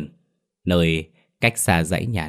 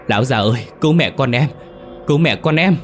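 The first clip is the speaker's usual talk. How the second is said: high, loud, desperate plea; terrified wife crying out to her husband; desperate cry for help; 7 semitones higher, 5 dB louder than usual. A narrator's reading. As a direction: call out for rescue in despair, climbing higher. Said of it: "Tiếng kêu cứu đầy tuyệt vọng".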